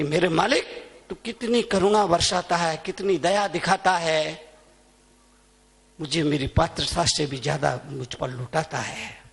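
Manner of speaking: low, soft, reverent address to God; acted humble prayer. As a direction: pray gently, as the saint. Saint's grateful prayer, acted. (धीमे स्वर में विनम्र प्रार्थना)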